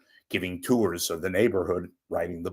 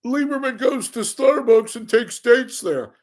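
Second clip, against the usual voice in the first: much higher, squeaky mock troll voice; sneering online troll, much higher; 7 dB louder than usual. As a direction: parrot the trolls in a silly high voice. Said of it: "before the trolls start".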